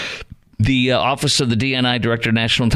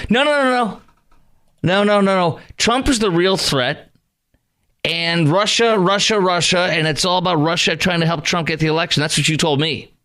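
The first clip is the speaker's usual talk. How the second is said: mocking; 6 semitones higher than usual. Mocking Democrats' Russia line.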